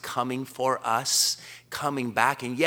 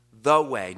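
"The way" said slow and louder, weight on "way"; "The way" as a firm, louder statement; stress on the way; 6 dB louder than usual. Shown as a demonstration. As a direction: lean hard into "The way". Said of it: "puts the emphasis on the way".

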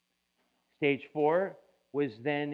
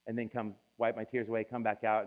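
ordinary talk, nothing marked

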